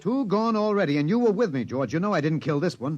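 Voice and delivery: high, exultant taunt; triumphant taunting. Acted. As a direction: rub it in with glee. Taunting aliens about death.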